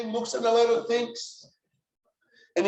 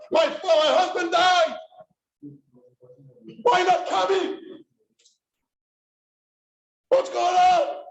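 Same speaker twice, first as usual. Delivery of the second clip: loud, high pleading shouts of the mother's letter; retold screaming; 6 semitones higher, 4 dB louder than usual. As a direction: cry out to him, demanding he come home. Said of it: "She screams in Yiddish".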